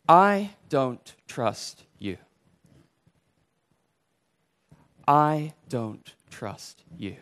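each word set apart slowly; slow, deliberate. Shown as a demonstration. Preacher sums up sin in four words, slow and deliberate. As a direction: spell it out word by word, unhurried.

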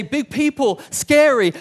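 high, loud mock alarm; mock fright. Israelites look at the big people, mock-frightened. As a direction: play at being scared of giants.

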